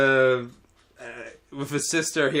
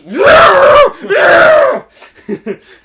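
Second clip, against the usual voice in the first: loud, high mock roar; imitated rage noises; 12 dB louder than usual. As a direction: roar wordlessly while hurling things. Imitating boy throwing crayons.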